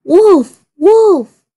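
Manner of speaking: loud, high calling out; boy crying for help, loud high shout. A narrator's reading. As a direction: yell it across the hills. Boy cries wolf.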